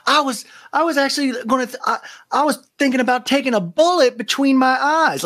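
high, fast dramatic voice; mock dramatic Shep. Shep telling Taylor his dark thoughts, high dramatic. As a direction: rush it melodramatically. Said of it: "He goes".

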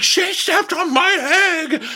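high, slow mock wail; crying little-boy tantrum. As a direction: bawl it out like a tattling little boy. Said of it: "literally crying like a little boy".

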